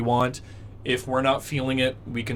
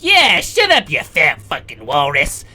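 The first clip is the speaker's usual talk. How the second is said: second giant head; 8 dB louder than usual. Acted. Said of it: "another one of his heads goes".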